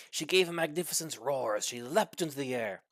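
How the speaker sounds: ordinary delivery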